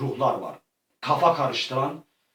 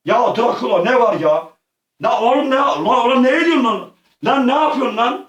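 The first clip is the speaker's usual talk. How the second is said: outraged shouting; 7 semitones higher, 5 dB louder than usual. Real, not acted. Attacked by Dracula.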